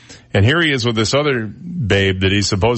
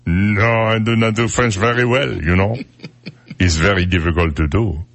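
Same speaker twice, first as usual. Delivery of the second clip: comic French accent, played up; halting mock-French English. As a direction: put on a French accent to deny it. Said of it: "so you can do French".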